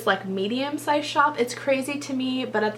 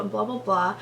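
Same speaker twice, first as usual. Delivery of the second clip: ordinary delivery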